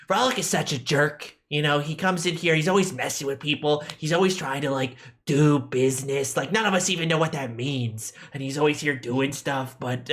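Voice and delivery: put-on lightly nasal kid voice; a hint of a chatty kid blacksmith; nasally kid voice. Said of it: "My nasally kid voice is my best voice".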